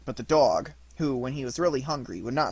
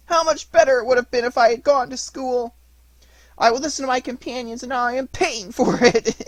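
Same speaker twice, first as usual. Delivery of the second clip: sobbing; 10 semitones higher, 7 dB louder than usual. Acted. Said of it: "And he said, sobbing".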